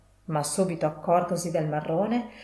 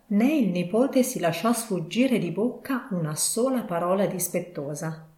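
ordinary delivery, nothing marked

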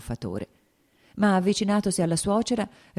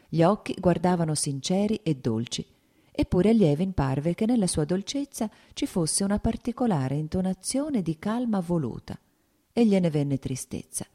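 ordinary delivery

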